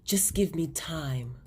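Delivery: slow, unvoiced whisper; whispered reply. Calvin answering Sabrina, slow whisper. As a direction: breathe it out slowly. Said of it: "Calvin replies".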